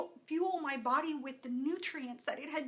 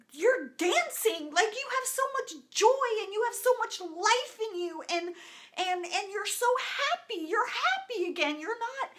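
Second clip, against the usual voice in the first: excited; 8 semitones higher, 6 dB louder than usual. Retold or imitated. Kids joyfully telling mom she's happy.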